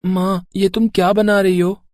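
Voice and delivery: put-on high young boy's voice, sulky; a small boy insisting he'll have fun; child voice. Little Bunty talking to his parents, high child voice.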